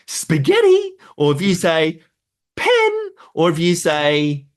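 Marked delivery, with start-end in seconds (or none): 0.1-0.9 excited dog voice. Shown as a demonstration. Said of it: "with the right excitement". 2.6-2.9 pen sung out much higher and louder, like a treat; excited word for a dog. Shown as a demonstration. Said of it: "with the right excitement".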